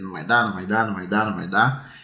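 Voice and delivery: mock discouraged kid. Quoting the discouraged boy in the last challenge, low mock whine. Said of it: "ele repetia diversas vezes".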